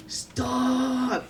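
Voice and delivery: high, drawn-out scared yell; retold scream. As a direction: yell it long and high in mock panic. Retelling a scared scream outside.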